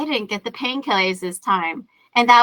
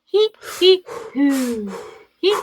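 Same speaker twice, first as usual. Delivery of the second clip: imitating the midwife; 5 semitones higher than usual. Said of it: "the midwife was all like".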